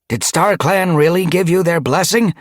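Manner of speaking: high, loud, doubtful question; worried questioning. A narrator's reading. Mallownose challenges Ice Star before the clan, worried. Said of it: "gaze glittered with worry".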